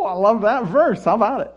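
hammed-up glee at the verse; mock delighted. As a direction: relish it like a sly joke.

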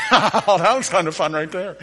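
chuckling through the words; amused, laughing aside. Real, not acted. Laughs after the game.

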